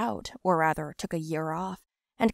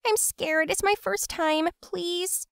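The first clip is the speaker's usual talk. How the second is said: scared pleading; 10 semitones higher, slower than usual. Acted. Gina begging, scared.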